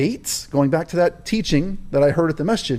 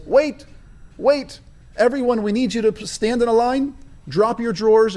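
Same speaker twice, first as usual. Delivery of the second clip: raised, higher calling voice; 7 semitones higher than usual. Retold or imitated. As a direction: halt the crowd with a shout. Paul voiced halting the crowd.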